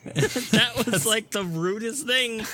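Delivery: high, incredulous protest; laughing outrage. Real, not acted. Reacting to a friend's rude joke, amused outrage. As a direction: call out the friend, scandalised.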